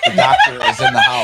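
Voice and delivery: high, loud announcer-style intro; laughing hype call. Acted. Hype self-introduction on show.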